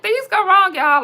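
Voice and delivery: high, loud, breezy proclamation; excited exclaim. Retold or imitated. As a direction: shrug it off out loud, cheerfully. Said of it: "And I be like".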